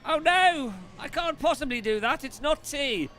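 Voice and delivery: mock English reply.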